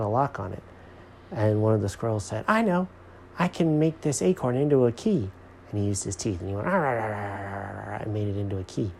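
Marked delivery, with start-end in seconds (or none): 2.5-5.3 high, bright-idea squirrel voice. A narrator's reading. 6.7-8.0 grind out a long toothy gnawing sound; gnawing noise. A narrator's reading. Imitates gnawing teeth.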